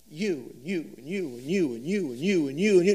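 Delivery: each you punched in a sing-song chant; rhythmic repetition. Acted. Preacher naming each listener, chanting you again and again. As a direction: point to every listener in turn.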